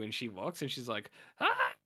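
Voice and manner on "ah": short, very high mock scream; sudden high-pitched gasp of horror; shocked shriek. Girl finding her brother dead, mock shriek.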